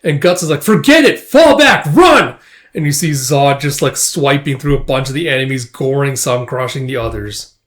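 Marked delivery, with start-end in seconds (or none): 0.6-2.4 high, loud retreat order; urgent shout. Acted. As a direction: yell acted orders to flee.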